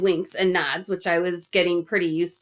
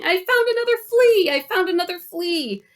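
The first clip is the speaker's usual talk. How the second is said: very high, triumphant cry; excited shouting; 11 semitones higher, 4 dB louder than usual. A narrator's reading. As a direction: shout it like a thrilling discovery.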